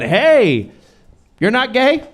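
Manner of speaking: high, startled dad voice; surprised dad. Retold or imitated. Comic telling his dad about the baby, dad's shocked high reply. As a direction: play the baffled father, pitch jumping up. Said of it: "And my dad said".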